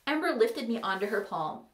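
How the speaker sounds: plain reading voice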